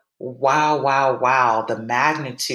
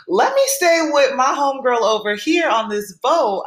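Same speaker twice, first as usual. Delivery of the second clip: high, loud inner plea; mock pleading; 9 semitones higher, 4 dB louder than usual. Swimmer eyeing the one woman staying aboard, mock pleading. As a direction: plead it high and emphatic, drawn along in one breath.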